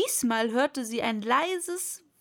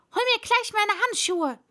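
high, loud command; bossy master calling out; shouted order; 9 semitones higher, 6 dB louder than usual. A narrator's reading. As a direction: order the maid about impatiently. Rabbit orders maid to fetch gloves.

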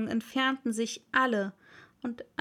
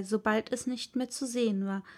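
plain reading voice

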